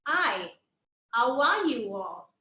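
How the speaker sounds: greeting drawn out syllable by syllable; slow, deliberate hello with a gap after "Hi"; slow, separated greeting. Shown as a demonstration. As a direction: stretch each word for a puppet's mouth. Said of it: "I did".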